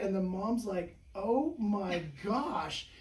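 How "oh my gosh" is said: slow, drawn-out mock amazement, higher and louder; amazed gasp. Mom watching her baby's neck lengthen, drawn-out amazement. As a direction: stretch it out like a stunned mom.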